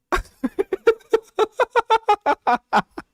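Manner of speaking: shrill squealing laugh burst; high shrieking laugh. Real, not acted. Reacting to clip.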